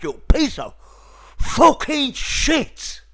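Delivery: loud, high, dragged-out yell; furious shout. Real, not acted. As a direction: bellow it out, stretching every word. Furious at Streamlabs failing.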